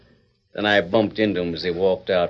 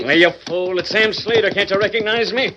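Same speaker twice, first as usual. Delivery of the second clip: yelling; 10 semitones higher, 4 dB louder than usual. Acted. Rider yells at hidden guard.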